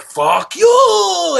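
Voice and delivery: high, loud mimicry of the catchphrase; hammed-up echo. Friends greeting the kid with his catchphrase, hammed-up. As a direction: belt it out high with the last word drawn out. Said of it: "you know, we'd go".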